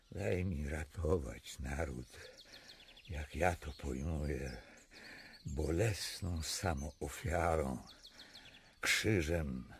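low, soft, resigned; resigned sorrow. Acted. King resigning himself to sacrifice, low and soft. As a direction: quietly accept the sacrifice.

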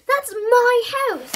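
high, loud, slow exclamation; shocked. A narrator's reading. Bertie spots his house for sale, shocked.

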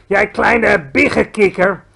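loud, slow furious giant; roaring insult. A narrator's reading. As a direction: roar the insult at him. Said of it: "brulde de bloedbottelaar". (woedend, langzaam gebruld)

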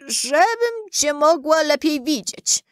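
high, slow put-on granny voice; wolf posing as grandmother. A narrator's reading. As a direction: draw it out high, as a disguised wolf. Wolf poses as grandmother answering.

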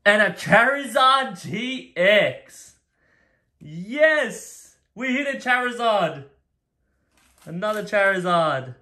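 high, loud and slow with delight, then a joyful yell; thrilled shout. Real, not acted. Collector pulling a Charizard on camera, excited yell. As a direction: burst out over the lucky pull. Pulls a rare Charizard card.

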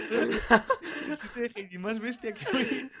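high, very slow words pushed out through laughter; barely getting it out between laughs; laughing. Real, not acted. Cracking up at the Heidi joke with his friend, laughing through it.

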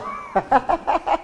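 airy giggle breaking through; high breathy laugh. Real, not acted. Cracking up mid-ad, breathy high laugh. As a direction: laugh at your own bit.

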